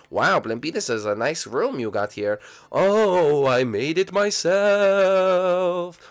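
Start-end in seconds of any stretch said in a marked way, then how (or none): 2.7-6.0 high, very slow ghost voice; drawn out and pleased with himself; proud ghost. Acted. Blimpy boasts to Luigi about his room, high and slow.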